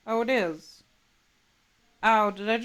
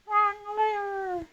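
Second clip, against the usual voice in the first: much higher squeaky mock reply; Kronk quote; 13 semitones higher, 3 dB louder than usual. Streamer finishing the Kronk joke in a high mock voice. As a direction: pitch it way up as the Kronk line.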